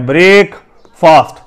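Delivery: بریک فاسٹ split in two, "brayk... faast"; long vowels in both halves of بریک فاسٹ; common mispronunciation. Shown as a demonstration. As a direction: separate بریک and فاسٹ, drawing out "ay" and "aa". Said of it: "جسے ہم بریک فاسٹ بولتے ہیں".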